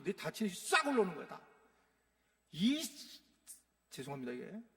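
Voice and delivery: ordinary talk, nothing marked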